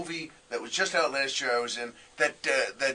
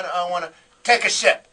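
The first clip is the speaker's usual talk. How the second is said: retold outburst; 2 semitones higher, 6 dB louder than usual. Acts out his baffled, stammered reply.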